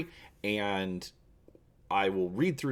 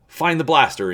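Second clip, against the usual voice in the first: raised, urgent call for the blaster; insistent call; 8 semitones higher, 9 dB louder than usual. Acted. Han urging his co-pilot in the dark, an insistent raised call. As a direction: push it out loud and high. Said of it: "insists Han".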